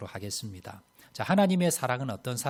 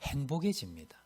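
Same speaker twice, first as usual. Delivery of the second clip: ordinary talk, nothing marked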